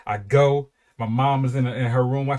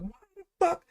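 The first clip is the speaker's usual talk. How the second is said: put-on squeaky kid self; 6 dB quieter than usual. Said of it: "I didn't say it like that".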